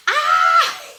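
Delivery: loud, high-pitched cry of emotion; real emotional outburst, high and loud; tearful squeal. Real, not acted. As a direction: let out a high, overwhelmed wail. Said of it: "I'm trying not to cry".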